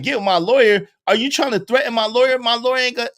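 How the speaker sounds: high, loud, rushed panic; freaking out over her lawyer; frantic J-Lo. Retold or imitated. [name] panicking at [name] about her lawyer, high and loud. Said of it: "And she like".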